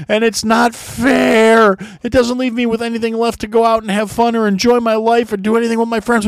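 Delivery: high, loud hammed-up whine; mock the moaning renter with a high, loud whine; mock whining.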